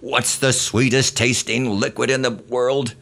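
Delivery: high shouted drill sergeant question; screaming drill sergeant. Retold or imitated. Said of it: "screamed at us".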